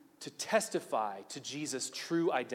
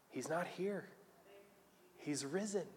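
soft angelic reassurance; hushed announcement; 13 dB quieter than usual. Acted. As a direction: break the news very softly. Angels gently announce the resurrection.

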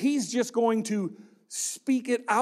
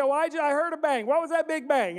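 higher, fast puzzled mom voice, lightly done; mock mom; 5 semitones higher, faster than usual. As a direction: play the curious mom. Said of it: "And she said".

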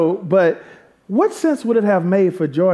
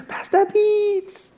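high, drawn-out mock greeting squeal; excited churchgoer; much slower than usual. Member spotting the pastor at the coffee stop, hammed-up excited call. Voices someone running up to him.

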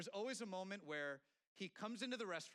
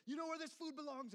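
much higher, louder imitated outburst; mock angry chef; 12 semitones higher, 3 dB louder than usual. Chef scolding the restaurant owners, hammed-up anger. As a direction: play the furious chef tossing the food. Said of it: "people get yelled at".

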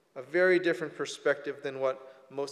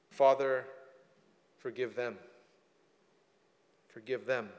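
tender prayer; 3 semitones lower than usual. Acted. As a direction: let the words fall gently, pleading. Jesus praying for his mockers.

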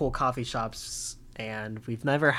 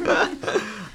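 high squeaky giggle; 12 semitones higher than usual. Real, not acted. Laughing at mock outrage.

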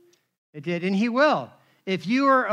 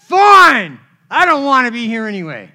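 retold angry shout; 11 dB louder than usual. Julio yelling back at the pastor, a loud angry shout. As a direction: shout it back like a stubborn man storming off.

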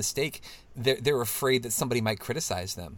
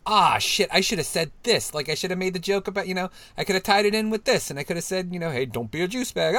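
higher, frantic self-reproach, replaying the missed joke; kicking yourself; 6 semitones higher, 3 dB louder than usual. Imagining the missed joke afterwards, mock frantic self-reproach. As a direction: scold yourself for the comeback you missed. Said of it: "you're like".

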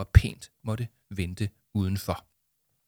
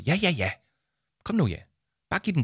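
perky; 7 semitones higher, faster than usual. Acted. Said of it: "svarede Jama kækt".

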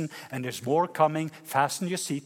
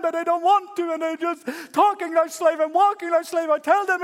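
high, loud, pleading complaint to God; exasperated Moses; 13 semitones higher, 5 dB louder than usual. Acted. Moses complaining to God about his people, high and loud. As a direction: whine up at heaven like a fed-up leader. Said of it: "Moses have had enough".

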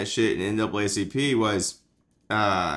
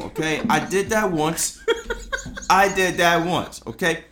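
hammed-up Italian-American wiseguy; comic Italian-American mob accent; 2 semitones higher than usual. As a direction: ramble like a mock Italian mobster. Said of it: "I get to do my Italian accent".

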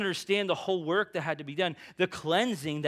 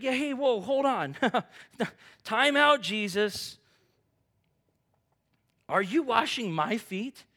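higher, louder startled protest with a laugh; startled Peter; 7 semitones higher, 6 dB louder than usual. Retold or imitated. Preacher playing Peter stopping Jesus washing his feet, startled and loud. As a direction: throw up your hands and stop him mid-wash.

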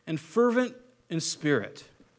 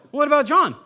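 higher, louder blurted question; blurting out loud; 7 semitones higher, 7 dB louder than usual. Acted. Peter answering Jesus out loud, blurted loudly. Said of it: "Out loud".

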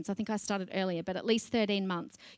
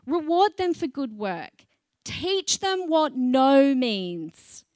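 slow emphatic; 2 semitones higher, 5 dB louder, slower than usual. Real, not acted. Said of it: "preaching emphasis".

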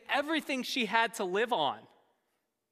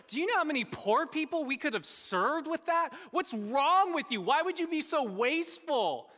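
high, heated scolding; indignant disciples; 8 semitones higher, 4 dB louder than usual. Acted. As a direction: act out outraged disciples berating her. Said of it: "they were indignant with her".

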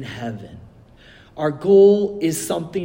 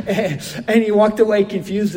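laughing; 4 semitones higher, 4 dB louder than usual. Real, not acted. Laughing while retelling the joke.

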